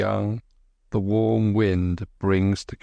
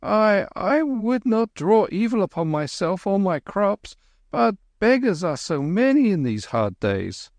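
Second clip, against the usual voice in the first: nervous stammer; 11 semitones higher than usual. A narrator's reading. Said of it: "the man stammered".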